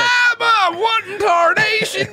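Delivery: put-on high, slow Old West holler; old prospector. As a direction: shout it like a grizzled gold prospector. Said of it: "That's the Old West sound effect".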